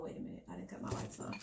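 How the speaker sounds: unvoiced muttered aside to self; whispered aside. Real, not acted. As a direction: drop to a whisper for a quick aside. Aside to herself, lights off.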